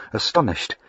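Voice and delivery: ordinary delivery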